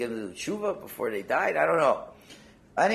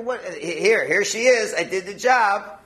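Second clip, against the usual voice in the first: higher, louder eager helper voice; agent's reply; 6 semitones higher, 5 dB louder, slower than usual. Acted. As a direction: proudly report the job done. Angel answers boss, acted quote.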